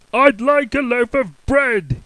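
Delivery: loud, slow high boom. Acted. Mister Noisy booming at the baker. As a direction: boom it slowly. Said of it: "he boomed".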